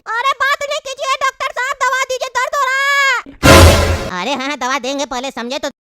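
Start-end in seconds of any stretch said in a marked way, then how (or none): 0.1-4.2 put-on high, wailing child voice; squeaky kid pleading through pain; high, urgent begging, swelling louder at the end; little boy in agony. Child in pain begs the doctor.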